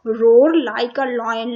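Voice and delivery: drawn out, stressing roar; slow dictation. Shown as a demonstration. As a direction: read it very slowly so kids can follow. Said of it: "lion की noise को क्या बोलते हैं?". (धीरे-धीरे, roar पर ज़ोर देकर)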